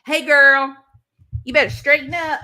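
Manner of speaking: loud mock-scolding mother; mama's scolding. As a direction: scold her like a mother would. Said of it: "my mama saying".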